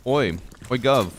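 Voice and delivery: loud, high British "guv" greeting; British accent. Shown as a demonstration. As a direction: call out in a put-on British accent. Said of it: "practice my accents".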